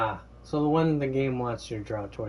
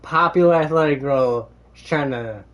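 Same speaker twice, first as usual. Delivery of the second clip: loud outburst; 3 semitones higher, 10 dB louder than usual. Real, not acted.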